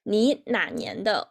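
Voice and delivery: ordinary talk, nothing marked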